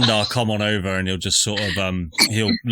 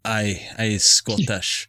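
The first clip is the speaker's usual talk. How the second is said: put-on Scottish accent; slower than usual. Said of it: "You were Scottish earlier".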